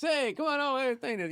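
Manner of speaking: high, hammed-up inviting call; mock cigar smoker. Guest mocking a lone cigar smoker calling out, high mock call. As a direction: mimic a lone smoker waving people over, pitched high. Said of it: "Sitting there like a city bus".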